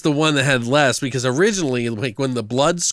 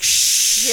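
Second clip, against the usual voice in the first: sharp "shh" like a librarian; shushing; 10 semitones higher than usual. Retold or imitated. Librarian scene retold to a friend, shushing.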